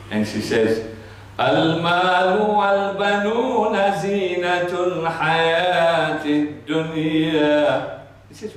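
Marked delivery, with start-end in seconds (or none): 1.4-8.0 melodic chant slowing at the end; Quran recitation. Acted. Woman telling him about her sons in a chanted verse. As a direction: intone the verse, drawing out the last word.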